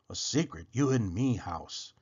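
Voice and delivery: usual speaking voice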